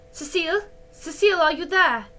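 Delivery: high, loud call through the door; anxious mother calling out loud; breathless calling. A narrator's reading. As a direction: call out breathless for her daughter. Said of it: "a breathless voice cried".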